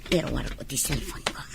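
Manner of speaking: spying with her phone, whispering. Acted. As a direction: sneak the phone out quietly. Spying, taking photo.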